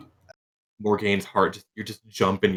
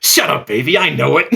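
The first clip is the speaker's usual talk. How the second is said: cocky Garrow; 7 semitones higher, 8 dB louder than usual. Acted. Garrow replies to compliment.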